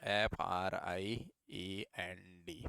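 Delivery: slow, letter by letter, each letter apart; spelled slowly for students; spelling out slowly. Shown as a demonstration. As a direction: spell the word out for the class. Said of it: "देखो friend".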